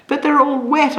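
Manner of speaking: high, tearful protest; upset child sobbing to her mother. A narrator's reading. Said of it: "I sobbed".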